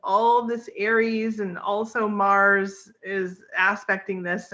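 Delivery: usual speaking voice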